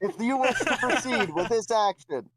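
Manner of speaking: the head god asks the hero to confirm; booming head god. Acted. Head god questioning Dino, grand and formal. God gives absurd instruction.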